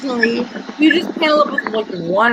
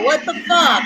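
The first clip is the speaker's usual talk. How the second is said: laughing outburst; 5 semitones higher than usual. Real, not acted. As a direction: burst out laughing and shout it. Surprised by a friend joining.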